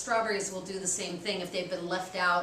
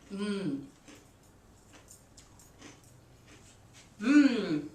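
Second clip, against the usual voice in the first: yummy mmm. Real, not acted. Tasting the chips just made.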